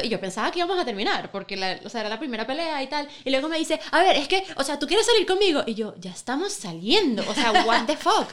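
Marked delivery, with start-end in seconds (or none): 3.9-5.6 imitating him, pitched up and louder; raised, puzzled voice quoting him; mock baffled boyfriend asking; boyfriend's question. Retelling boyfriend's confused question. 6.0-8.3 laughing through the words; amused disbelief, laughing; giggling retort; laughing reply. Retold or imitated. Retelling her own laughing reply.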